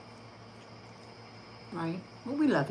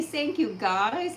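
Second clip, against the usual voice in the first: lightly raised dog voice; voicing the dog; 5 semitones higher, much slower than usual. Owner speaks for her dog thanking viewers, lightly higher.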